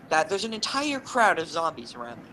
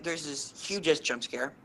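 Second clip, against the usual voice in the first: ordinary talk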